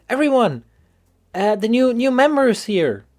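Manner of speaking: high put-on girl voice; energetic schoolgirl announcement. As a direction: announce it to the club in a high, eager voice. Voices Sayori announcing new member.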